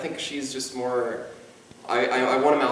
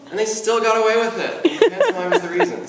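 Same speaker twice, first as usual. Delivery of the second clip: laughing; 7 semitones higher, 5 dB louder than usual. Real, not acted. Mimes got away with it, laughing.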